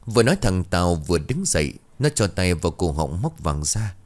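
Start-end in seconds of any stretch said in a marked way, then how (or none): none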